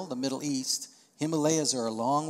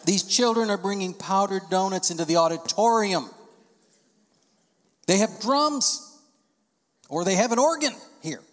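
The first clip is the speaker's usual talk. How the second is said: mock indignant churchgoer; 5 semitones higher, 6 dB louder than usual. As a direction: ham up the outrage of a grumbler. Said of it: "I've heard that before".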